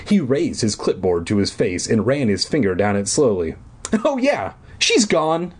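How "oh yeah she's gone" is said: slow, high, breezy counselor; airy, offhand counselor; camp counselor voice. A narrator's reading.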